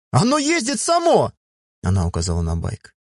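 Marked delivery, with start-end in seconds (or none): 0.1-1.3 put-on high, eager girl calling to her father; excited shout. A narrator's reading. Miya calls her father over to the bike, high excited shout. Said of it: "закричала Мия".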